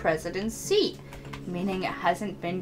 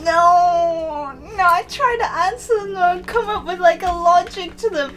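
dismayed protest; 9 semitones higher, 6 dB louder than usual. Real, not acted. Realizing the questions were nonsense.

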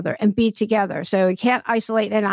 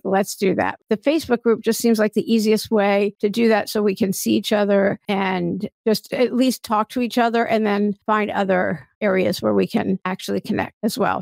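ordinary talk, nothing marked

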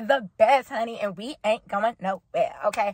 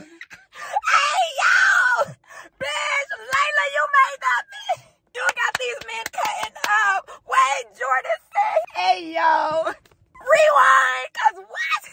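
screaming excitement; 12 semitones higher, slower than usual. Real, not acted. Fan yelling at the screen over Layla's secret, excited. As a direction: lose it at the reveal, yelling.

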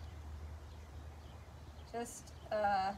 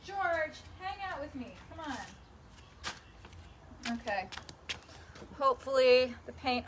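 ordinary talk

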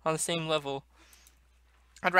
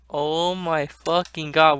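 normal talking voice

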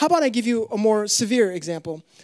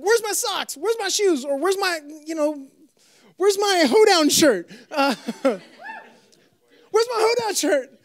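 put-on whiny kid; 6 semitones higher, slower than usual. Whiny kid asking parents.

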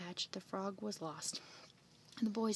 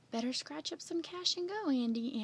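higher-pitched parting call; 6 semitones higher than usual. A narrator's reading. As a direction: call it lightly while driving off. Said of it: "Aunt Minnie called as she drove away".

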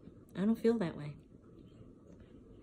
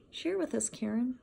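high singsong mock of the counselor; mocking group leader; 6 semitones higher than usual. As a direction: parrot the leader in a sugary mock voice.

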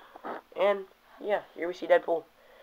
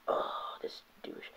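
breathy unvoiced whisper of disgust; muttered Iceman aside in a whisper; 13 dB quieter, much slower than usual. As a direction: hiss it under your breath. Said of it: "He's like".

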